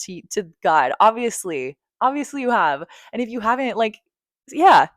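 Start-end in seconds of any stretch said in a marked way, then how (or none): none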